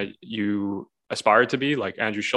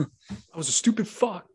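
Goggins impression; 10 semitones higher, slower than usual. Imitating Goggins audiobook voice.

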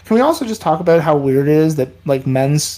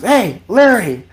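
loud call to husband; 5 semitones higher than usual. Retold or imitated. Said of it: "Really loud".

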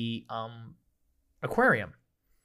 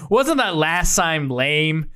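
snarky sign; slower than usual. Acted. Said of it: "next sign after it ... goes".

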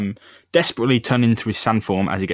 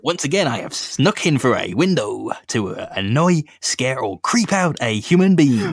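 Stan Lee voice; 2 semitones higher than usual. Acted. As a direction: spin it like a grand comic-book cameo. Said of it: "why is your Stan Lee so good?".

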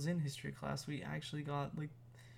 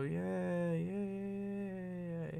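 sarcastic cheer; 4 semitones higher, much slower than usual. Acted. Sarcastic cheer over hated portfolio.

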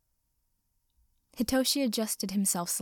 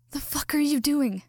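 choked, strained and higher; 6 semitones higher, 5 dB louder than usual. A narrator's reading. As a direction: force it out through a tight throat. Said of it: "choked out, voice strained".

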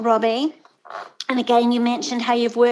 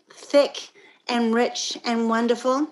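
ordinary delivery

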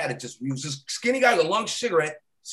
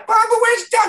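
much higher and louder tearful cry; high crying plea; 9 dB louder than usual. Retold or imitated. Crying circus girl begging for Doug, high loud mock wail.

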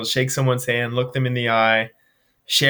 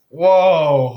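amazed whoa; 4 semitones higher, 7 dB louder than usual. Retold or imitated. Amazed by the boy's words.

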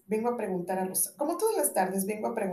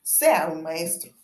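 conceding bow; 4 semitones lower, 7 dB louder, slower than usual. Acted.

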